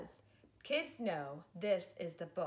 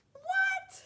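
very high, loud squeal; an over-the-top startled "what?"; mock shock; 8 dB louder than usual. Mock shock at bug rhyme.

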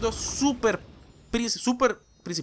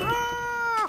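high-pitched, drawn-out cry of dismay; high wail. Real, not acted. Player unsure of the route, wailing. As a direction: let out a long high "ah". Things going wrong in game.

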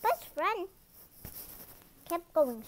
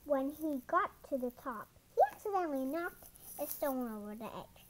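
usual speaking voice

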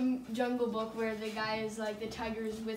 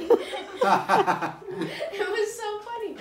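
slow, high and loud through laughter; can barely get it out for laughing; 10 semitones higher, 8 dB louder, much slower than usual. Real, not acted. Teen recalling his disbelieving mom, laughing hard. Laughing while telling the story.

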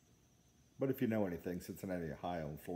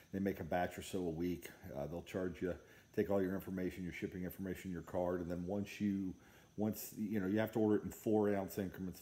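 ordinary talk, nothing marked

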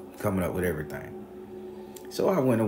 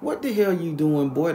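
high, loud challenge; imitated angry neighbor; 10 semitones higher, 6 dB louder than usual. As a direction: confront a stranger, acting out the neighbor. Neighbors challenge him in the story.

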